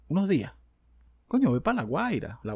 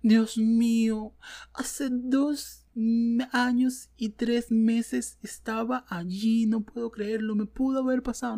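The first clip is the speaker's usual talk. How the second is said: high, slow melodramatic mock voice; mock dramatic poster; 8 semitones higher, much slower than usual. Mocking a self-centred poster, hammed-up dismay. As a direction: ham up the shocked online poster. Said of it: "sale alguien".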